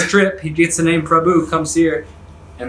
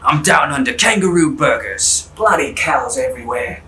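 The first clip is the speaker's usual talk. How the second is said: hammed-up Australian nature-show host; mock Australian accent. Joker posing as Steve Irwin out in nature, broad Australian accent. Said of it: "Australians sound hilarious".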